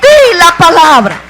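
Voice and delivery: loud, ringing command; Jesus commanding. Acted. Preacher voicing Jesus's command to speak, loud. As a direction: declare it like an order from Jesus. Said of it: "Jesús dijo".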